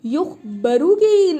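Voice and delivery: drawn out for the students; slow teaching emphasis. Shown as a demonstration. Teacher slowly spelling out the passive form for students. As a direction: stretch the word slowly so they catch it. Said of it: "उसे inform किया गया है".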